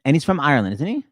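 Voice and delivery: ordinary talk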